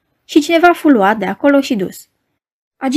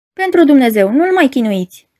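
plain reading voice

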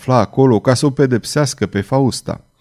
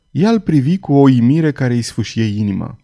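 usual speaking voice

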